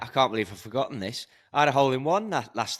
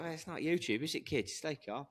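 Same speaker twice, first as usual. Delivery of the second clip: much softer, hushed calm aside; mock calm; 5 semitones higher, 11 dB quieter than usual. Joking to the lads it's not YouTube, hushed mock calm. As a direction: hush it like calming kids. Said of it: "you're so calm about it".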